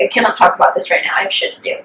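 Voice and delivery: quick, whispered brush-off; hurried whisper, dismissive; hushed quote. Retold or imitated. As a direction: mutter it fast under your breath. Texting while too upset.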